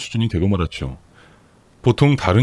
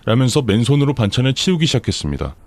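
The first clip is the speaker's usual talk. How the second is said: ordinary delivery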